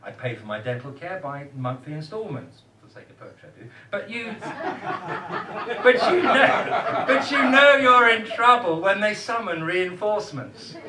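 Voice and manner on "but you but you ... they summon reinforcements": laughing through the punchline; giggling loudly at his own joke. Real, not acted. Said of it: "See what I did there?".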